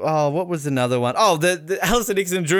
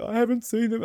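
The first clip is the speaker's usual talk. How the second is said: bawling; 9 semitones higher, 4 dB quieter than usual. Retold or imitated. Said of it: "just bawling his eyes out".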